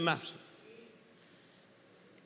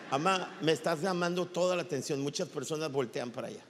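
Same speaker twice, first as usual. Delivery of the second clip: laughing aside; 8 semitones lower, 6 dB quieter, faster than usual. Real, not acted. Aside to his mother mid-sermon.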